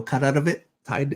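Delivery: ordinary talk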